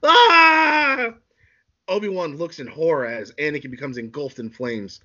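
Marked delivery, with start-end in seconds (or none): 0.0-1.1 long, high, loud scream; agonized drawn-out scream. Acted. Anakin bursts into flames, loud high scream. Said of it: "starts screaming".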